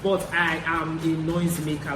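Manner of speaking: ordinary talk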